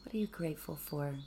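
soft, calm meditation voice, sometimes whispered; hushed gratitude meditation; guided meditation. Acted. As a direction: ease listeners inward with gentle questions.

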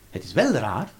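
ordinary talk

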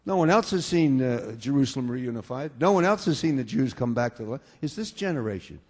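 usual speaking voice

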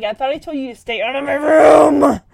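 put-on loud teenage yell at his sister; furious big brother shouting; angry shout. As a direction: bark it at the kid sister. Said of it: "Michael gets angry".

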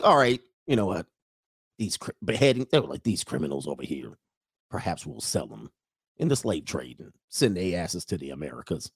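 low, hushed voice of a scheming ruler; mock ruler voice. Mocking the old rulers who sold people, low and hushed. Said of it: "And they was like".

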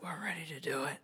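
breathy sneaky whisper. Acted. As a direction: keep it a hushed secret. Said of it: "a nice little sneaky, uh, psst".

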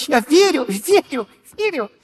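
high, repeated «верю» fading off; insistent belief. Shown as a demonstration. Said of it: "performs belief".

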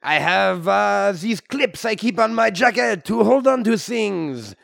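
put-on comic French-Canadian accent; hammy Québécois hero voice. French-Canadian Wolverine character.

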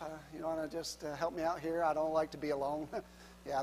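normal talking voice

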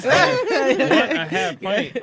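laughing. Real, not acted. Laughing while explaining the trick.

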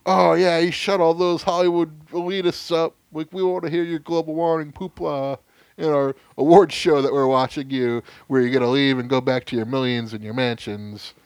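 high, gloating mock voice; mocking fan voice. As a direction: sneer like a smug fan. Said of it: "they're all like".

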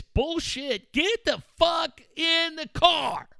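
scoff, then order them into the car; angry rant. Retold or imitated. Ranting parent, acted shouts.

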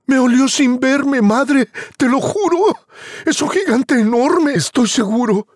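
put-on high, pleading boy voice; insistent scared boy. Juan swears to his mother about the giant. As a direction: swear it desperately.

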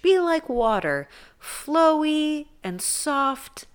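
mock-serene guru voice; soft and flowy. Shown as a demonstration. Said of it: "with the softness that I was truly describing".

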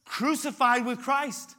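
loud, slow preaching of the verse; fiery sermon quote; preaching scripture. Acted. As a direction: proclaim it with full force. Preacher quotes Paul fervently.

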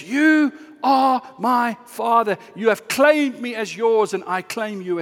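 ringing pulpit cry; bold proclamation. Acted. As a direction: proclaim it slowly and forcefully. Acted cry to God, proclaimed.

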